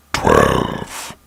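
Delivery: very low, drawn-out monstrous announcer; spooky countdown voice. Acted. Narrator announces the next creepy story, deep spooky countdown. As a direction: drop deep and stretch the number out.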